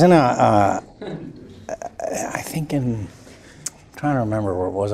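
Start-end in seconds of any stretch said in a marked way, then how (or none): 2.3-4.9 much softer, half whispered; a soft thinking-aloud aside; quiet aside. Real, not acted. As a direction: mutter it to yourself while remembering. Aside while trying to recall.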